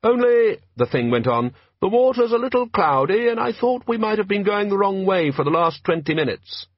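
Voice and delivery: a small metal thing asking the way politely; tinny polite voice. A narrator's reading. Sea creature politely asking a raft for directions, tinny voice.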